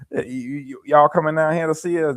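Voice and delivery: put-on high, teasing country-relative accent; mock country accent. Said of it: "They be talking like that".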